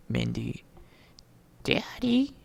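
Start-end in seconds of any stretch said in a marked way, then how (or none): 1.6-2.3 put-on high, pleading little-girl "Daddy"; a breathy, high princess plea; pleading daughter. Mindy pleading with her father.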